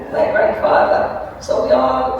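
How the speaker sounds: normal talking voice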